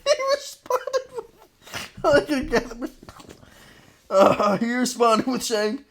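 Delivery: words squeezed out between high giggles; laughing through words. Real, not acted. Telling the co-host the reply while crying with laughter. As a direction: try to talk while laughing helplessly. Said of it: "I'm crying so much right now".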